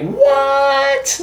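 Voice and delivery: a very high, rising gasp of a word; stunned disbelief; shocked what. Retold or imitated. Audience hearing the head is in the box, shocked.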